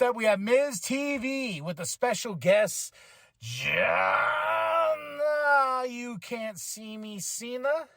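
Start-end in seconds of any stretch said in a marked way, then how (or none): 3.4-7.9 big drawn-out wrestling announcer call, the name held up high; mock ring announcer introducing a wrestler; ring announcer intro. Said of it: "I said that, right?".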